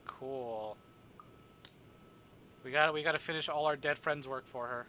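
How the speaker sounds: usual speaking voice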